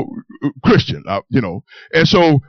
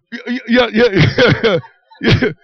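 higher, juddering broken syllables; imitated shaking stutter; 4 semitones higher, much slower than usual. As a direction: stammer as if the body is vibrating. Acts out filling, body starts vibrating.

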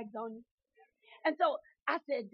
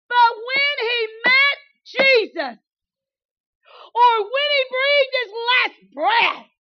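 slow, then rising to a high shouted cry; full-force preaching; 9 semitones higher, 17 dB louder, slower than usual. Acted. Preacher tells the congregation about Steve Jobs' death, building to a cry. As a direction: build the sermon to a crying climax.

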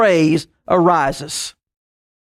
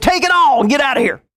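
raised, higher-pitched retold command; acted exasperated outburst; 5 semitones higher than usual. Preacher voicing Egyptians sending Israel off, acted exasperated outburst. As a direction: cry it out as if driving them away.

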